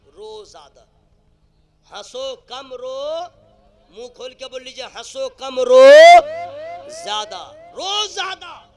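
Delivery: loud, high slogan delivery; preacher calling for the audience to repeat; rousing preaching. Real, not acted. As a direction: lead the crowd in a ringing refrain. Leads crowd in preached refrain.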